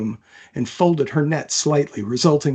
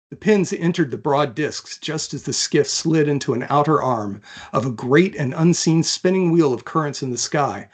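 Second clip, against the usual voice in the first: usual speaking voice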